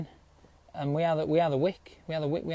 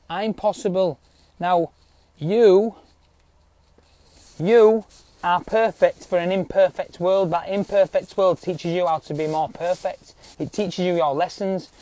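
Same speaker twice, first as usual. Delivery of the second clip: usual speaking voice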